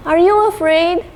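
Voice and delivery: high playful ask; teasing question. Acted. Teacher playfully asking children if they are scared. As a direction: tease the kids about being scared.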